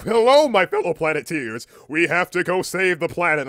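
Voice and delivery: booming, high cartoon hero call; Captain Planet. Captain Planet rallies the Planeteers, booming mock-heroic. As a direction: announce yourself at full volume like a superhero. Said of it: "Captain Planet, like, busts through the fucking window and he's like".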